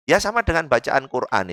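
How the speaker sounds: ordinary delivery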